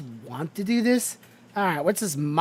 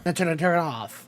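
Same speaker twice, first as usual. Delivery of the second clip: voice changer on. Shown as a demonstration. Streamer tests his voice changer, filtered. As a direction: voice run through a changer filter. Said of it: "So I do this".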